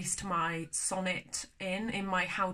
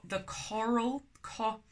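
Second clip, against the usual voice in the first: CORAL with weight on "co", drawn out; stress on first syllable; 3 semitones higher, slower than usual. Shown as a demonstration. Teacher showing where coral is emphasised, stressed first syllable. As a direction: lean slowly on the "co" of "coral". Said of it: "is the emphasis word".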